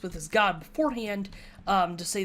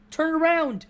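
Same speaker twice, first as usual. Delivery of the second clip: loud, high call to turn; shout; 8 semitones higher, 6 dB louder than usual. Retold or imitated. Player retells shouting to distract enemies, a loud high shout. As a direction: yell it to fool the guards. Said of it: "and shout".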